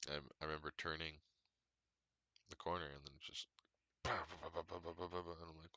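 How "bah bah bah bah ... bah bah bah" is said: rapid-fire bah-bah tire noise; quick repeated thudding mouth noise; imitated flapping tire. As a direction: mimic a blown tire thumping round. Imitating the tire going flat.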